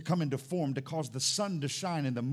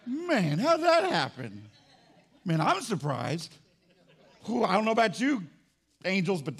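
laughing, higher mock astonishment; mock surprised God; 4 semitones higher, 6 dB louder than usual. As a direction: tease them by playing a clueless God. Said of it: "without God going".

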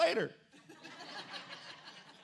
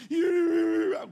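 mock road rage yell; 8 semitones higher, 8 dB louder than usual. Mimics yelling out car window.

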